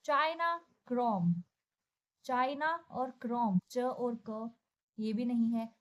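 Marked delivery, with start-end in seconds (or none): none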